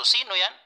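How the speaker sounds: "Sino yan?" with a high, rising question tune. Shown as a demonstration. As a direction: ask "Sino yan?" with a clear lift. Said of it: "kailangan patanong din po yung tono".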